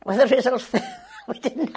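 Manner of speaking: amused, laughter between the words; laughing. Real, not acted. Volunteer admits the apple picking backfired, laughing. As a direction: chuckle through the admission.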